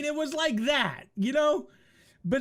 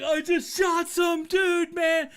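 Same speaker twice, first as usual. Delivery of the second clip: gleeful brag; 5 semitones higher, 4 dB louder than usual. Retold or imitated. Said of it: "And I was like".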